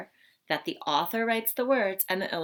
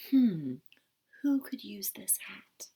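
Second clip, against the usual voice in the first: hushed, musing whisper; pondering whisper; slower than usual. Acted. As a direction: wonder to yourself like a thinking statue. Squirrel thinking like the Thinker.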